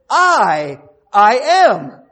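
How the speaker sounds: "I" very loud and long, then "I am" loud and high; emphatic double I. Shown as a demonstration. Preacher rendering Jesus' Greek words in English, stressed I. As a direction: hammer out "I", then "I am". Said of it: "So he says".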